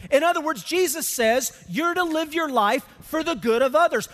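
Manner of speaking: loud, high preaching that drives each line home; full-force preaching. Acted. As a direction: preach it out to the whole room. Opening of sermon at full force.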